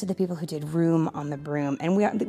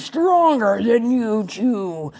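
slow, loud boastful troll; 4 semitones higher, 7 dB louder, slower than usual. Acted. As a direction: boom it out like a bragging troll. Troll voiced in story.

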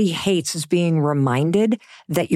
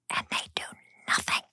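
dramatic hushed whisper; 12 dB quieter than usual. Acted. Speaker to audience, hushed whisper. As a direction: drop to a whisper for effect.

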